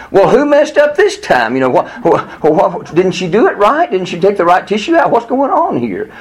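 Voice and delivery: high-pitched retold protest; exasperated. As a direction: fire off incredulous, accusing questions. Retelling his angry thoughts about treatment.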